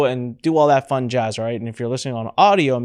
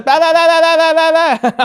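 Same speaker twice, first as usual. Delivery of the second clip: put-on much higher, loud angry baby shout; mock baby tantrum, high and loud; angry baby babble; 9 dB louder than usual. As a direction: wail like a furious baby at an ad. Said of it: "She gets angry".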